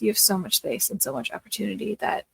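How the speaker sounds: usual speaking voice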